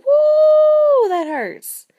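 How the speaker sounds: high, loud yelp of pain; wolf yelping in pain. Acted. Wolf burning his tail, yelping high and loud. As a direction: yelp like your tail is on fire.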